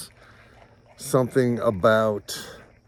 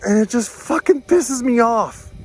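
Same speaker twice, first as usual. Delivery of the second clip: angry; 12 semitones higher, 6 dB louder than usual. Real, not acted. Repeated angry phrase.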